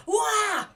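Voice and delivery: sudden high loud yelp of fright; startled yelp. Retold or imitated. Joe startled by a rattlesnake in the yard, high yelp. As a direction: jump and yelp wah at the snake.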